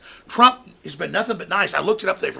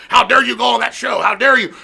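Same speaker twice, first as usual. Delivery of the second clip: high, loud indignant mock scolding; mock Tapper outrage; 4 semitones higher, 5 dB louder than usual. Mocking Tapper scolding a congressman, loud indignant. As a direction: sneer it like an outraged anchor. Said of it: "Tapper's like".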